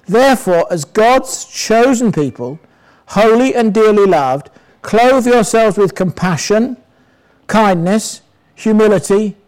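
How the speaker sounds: slow, measured Bible reading; a lightly solemn reading of Colossians; scripture reading. Acted. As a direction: read it out as scripture to the church. Reads Colossians aloud.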